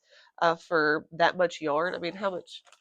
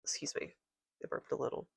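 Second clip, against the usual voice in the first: voiceless, much softer whisper; whispered excuse; 3 semitones lower, 12 dB quieter, faster than usual. Real, not acted. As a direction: drop to a sheepish whisper after the burp. Apologizing after a burp.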